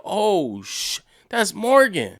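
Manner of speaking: high, loud, slow gasp of recognition; replaying the shock of realizing who it is; startled recognition. Retold moment of recognition.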